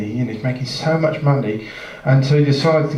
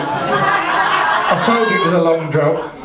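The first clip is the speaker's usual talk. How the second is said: laughing; 4 semitones higher, slower than usual. Real, not acted. Teller laughs at sleeping listener.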